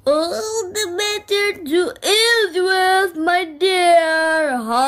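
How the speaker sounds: put-on wolf about to eat the girl; lightly voiced greedy wolf. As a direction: gloat over the meal. Wolf revealing himself.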